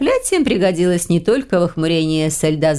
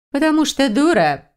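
higher-pitched mocking retort; lightly sneering, pitched up; smirking scorn; 8 semitones higher than usual. A narrator's reading. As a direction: toss it off with a smirk. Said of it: "усмехнулась Ехтиндра".

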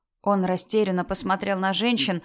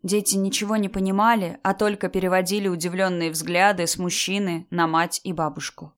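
usual speaking voice

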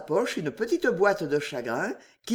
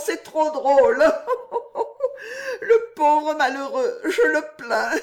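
high, breaking into fits of laughter; laughing helplessly at the idea of a husband; 8 semitones higher than usual. A narrator's reading. Juliette mocks fidelity to Jacques, shrieking with laughter. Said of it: "en riant toujours".

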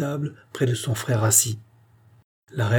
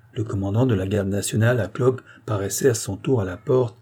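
ordinary delivery

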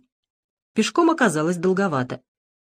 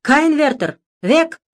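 high, loud barked German; barking German soldier; 8 semitones higher, 6 dB louder, slower than usual. A narrator's reading. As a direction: bark the words like a sentry. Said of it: "гавкнул патрульный". (лающий немецкий окрик)

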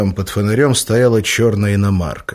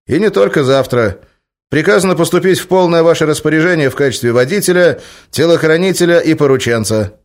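Oleg's voice; 8 semitones higher, 6 dB louder than usual. A narrator's reading. Oleg reports duties.

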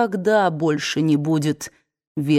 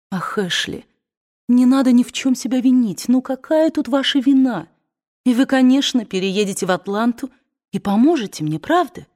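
put-on higher, eager pleading young woman; 7 semitones higher, 3 dB louder than usual. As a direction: coax him sweetly, hopeful. Scarlett pleading with Ashley.

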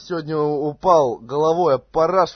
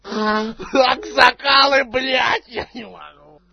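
high, slow shout through laughter; laughing shout; 8 semitones higher, 3 dB louder, slower than usual. Real, not acted. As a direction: crack up and shout the words. Caller laughing after hanging up. (кричит сквозь смех)

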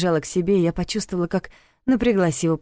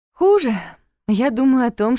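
high, loud burst of feeling; excited outburst; 6 semitones higher, 4 dB louder than usual. A narrator's reading. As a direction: blurt it out, much higher. Heroine confessing love teasingly.

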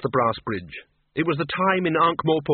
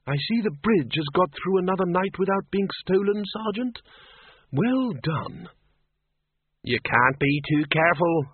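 higher-pitched character voice, dry irony; ironic old acquaintance; 4 semitones higher than usual. Acted. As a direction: tease the sergeant with mock praise.

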